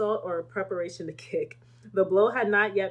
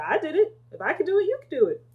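higher, louder encouragement; Jesus telling disciples they can do it too, higher and louder; Jesus' reply; 8 semitones higher, 5 dB louder, faster than usual. Pretend. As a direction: lift them up by example. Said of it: "Jesus said".

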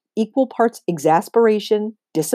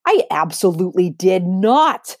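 shouting; 4 dB louder than usual. Acted. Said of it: "Rebecca shouts".

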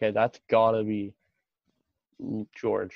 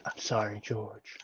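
soft, lower murmured apology; hushed mock apology; 3 semitones lower, 11 dB quieter, much slower than usual. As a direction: imitate a quiet, low "sorry" to a victim. Quotes character before shooting him.

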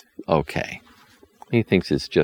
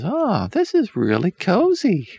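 put-on girl's voice. A narrator's reading. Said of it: "Regrettal ... says".

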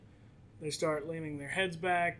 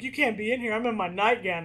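higher and louder, flustered; flustered protest; 5 semitones higher, 5 dB louder, faster than usual. Acted. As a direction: shoo him out, embarrassed. Faye flustered in her nightgown.

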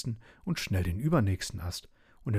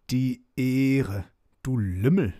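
louder, drawn-out mock outrage; indignant; 3 semitones higher, 5 dB louder, slower than usual. A narrator's reading. Wild dog answers cheeky Schnuddel, loudly indignant. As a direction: throw the word back as an insulted wild dog.

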